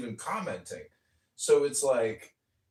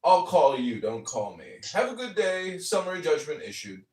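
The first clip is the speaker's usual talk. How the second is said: a hint of a curt official; dismissive judge; 5 semitones higher, 5 dB louder than usual. Mocking the court dismissing his case, curt. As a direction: brush him off briskly.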